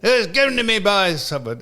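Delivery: high mock Scottish accent; Gary Todd. Retelling the caller's answer about the number, high Scottish accent. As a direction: imitate the Scottish caller explaining himself. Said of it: "he's Scottish".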